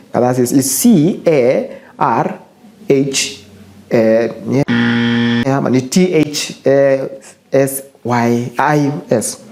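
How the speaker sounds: letters spelled out slowly, one by one; spelling it out. Shown as a demonstration. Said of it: "tafuteni dictionary yenu".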